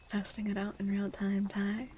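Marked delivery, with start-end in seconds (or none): none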